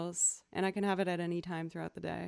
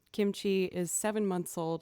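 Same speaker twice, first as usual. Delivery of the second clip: ordinary talk, nothing marked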